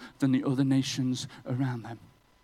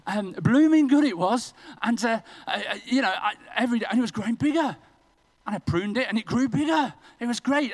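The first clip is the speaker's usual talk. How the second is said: higher and louder, bubbling over; gleeful excitement; 6 semitones higher, 4 dB louder than usual. Acted. Preacher gleefully recounting how his plant thrived. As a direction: tell it like a proud new gardener.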